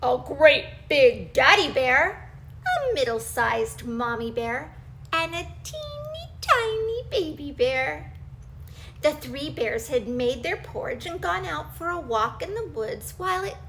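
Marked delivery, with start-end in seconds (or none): 5.1-8.1 high tiny voice. Acted. Introducing baby bear.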